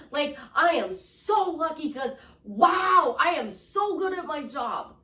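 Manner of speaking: gushing positive self-talk, lifted and punchy. Shown as a demonstration. Coach demonstrating upbeat affirmations about work, excited. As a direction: rave about it, stressing each big word. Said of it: "Raise your voice".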